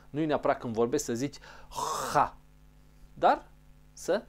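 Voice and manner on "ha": "ha" with exaggerated throat friction; over-pressed guttural "ha"; H with extra pressure. Shown as a demonstration. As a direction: press hard on the rasped H of "ha". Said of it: "Eu pun mai multă presiune acum".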